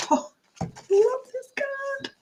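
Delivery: high drawn-out delight; delighted squeal. Real, not acted. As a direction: swoon over the card.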